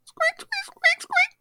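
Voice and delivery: very high squeaks; rubbery high squeaky toy. Pretend. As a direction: squeak like chalk on a cue tip. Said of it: "perform for me the sound".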